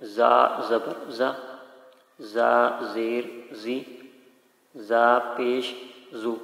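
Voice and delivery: "زا زبر زا" said very slowly; slow Qaida drill; slow clear spelling. Shown as a demonstration. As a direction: sound out "زا زیر زی" carefully. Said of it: "واضح ہونا چاہیے".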